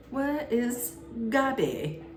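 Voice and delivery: bad English accent. Butler shows up asking for Gabby, badly faked English accent. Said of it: "That's the worst English accent".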